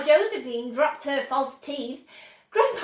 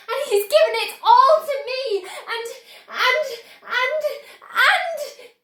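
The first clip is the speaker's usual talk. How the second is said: high and loud, tumbling over the words, a half laugh at the end; bubbling over too fast to finish; breathless excitement; 10 semitones higher, 7 dB louder than usual. A narrator's reading. Charlie telling his mother about the factory, overjoyed and breathless.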